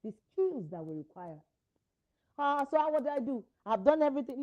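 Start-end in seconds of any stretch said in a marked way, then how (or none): 2.4-4.4 louder, higher, voicing a worn-out parent; exasperated parent. Voicing a frustrated parent at the end of their rope. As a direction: cry out like a parent at wits' end. Imagined tired parent crying out.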